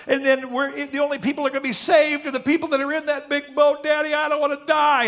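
put-on high, loud tearful little boy; hammed-up wail, building in panic; mock crying child. Little Billy warning Dad about the flood, mock crying. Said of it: "little Billy comes home crying".

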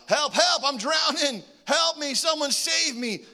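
high, loud pretend cries for help; mock drowning cries. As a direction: play a drowning man yelling for help.

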